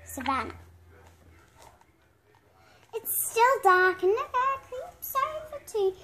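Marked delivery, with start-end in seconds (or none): none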